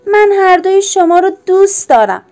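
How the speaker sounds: put-on a boy desperately taking back his words; crying out. Tim cries out to his father that he loves both parents, high and loud. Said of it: "تیم فریاد زد".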